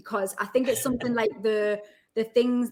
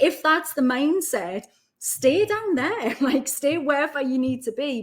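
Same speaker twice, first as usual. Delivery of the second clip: laughing dad quote; 6 semitones higher, 4 dB louder than usual. Retold or imitated. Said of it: "he's like".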